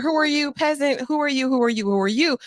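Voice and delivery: high, snooty demand; haughty lady of the house. Retold or imitated. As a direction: look down on a peasant. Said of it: "surveying her property".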